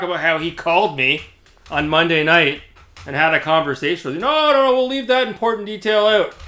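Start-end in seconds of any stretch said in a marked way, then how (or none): 4.2-6.3 much higher, sneering mock of his excuse; mocking imitation. Vlogger mocks the rival leaving out the phone call, sneering. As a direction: parrot him like a weasel dodging the truth.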